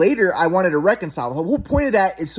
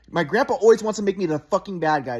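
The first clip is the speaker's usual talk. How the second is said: normal talking voice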